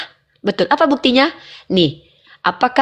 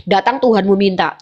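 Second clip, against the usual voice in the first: ordinary talk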